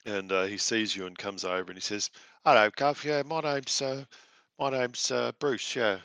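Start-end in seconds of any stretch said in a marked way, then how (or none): 2.4-6.0 cheery Cockney squire, lightly done; a chirpy Cockney lad greeting the party; a hint of Cockney; Cockney accent. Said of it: "With an accent like that".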